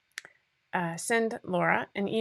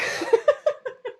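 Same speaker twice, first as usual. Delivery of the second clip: teary laugh; 4 dB louder than usual. Real, not acted. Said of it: "I'm getting teary-eyed".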